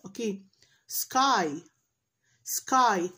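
ordinary talk, nothing marked

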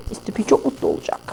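breathy whispered catchphrase; whispered Mister P line. Pretend. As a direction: whisper it like a secret.

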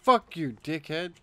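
loud furious cursing; angry shouting. Real, not acted. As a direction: yell the insult. Raging after being teabagged.

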